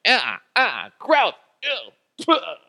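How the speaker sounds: high yucky spitting noises; mock retching and spitting; imitated gagging whale. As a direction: gag and spit it out like a disgusted whale.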